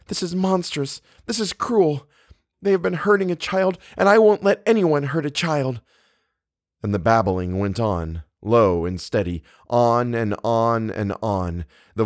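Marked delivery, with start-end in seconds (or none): 0.1-5.8 high, strained indignation; outrage. A narrator's reading. Eleanor refusing to let a child be hurt, outraged. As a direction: protest fiercely without shouting.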